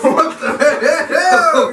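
high, slow words through laughter; laughing. Real, not acted. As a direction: squeal it out while cracking up. Laughing at a burp.

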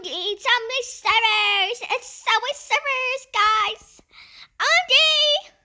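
high, loud hype; excited announcement. Real, not acted. Player announcing Subway Surfers to guys, excited. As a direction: hype up the game to viewers. Announces next game to viewers.

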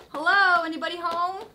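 loud high call, hello anybody home; calling down a hallway. Pretend. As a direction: call out playfully to someone inside. Knocking on toy door, calling.

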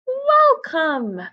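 high, loud squeaky call; a little lizard voice calling out; squeaky welcome. Acted. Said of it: "a squeaky voice called out".

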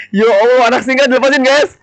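excited exclamation. Real, not acted. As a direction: exclaim it in delighted shock. Big gift arrives. (seru heboh penuh takjub)

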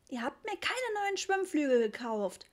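put-on high-pitched childish grumble; whining complaint. A narrator's reading. Richie complaining to his unheeding parents, whining. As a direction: whine it like a sulking child. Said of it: "maulte Richie".

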